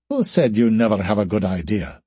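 ordinary delivery, nothing marked

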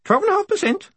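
very high parrot screech; piercingly high bird voice, screamed; screaming cockatoo. A narrator's reading. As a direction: squawk it like a talking cockatoo. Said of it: "yelled the bird".

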